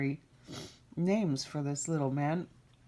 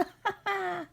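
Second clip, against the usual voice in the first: high goblin cackle. Acted. Imp gloating.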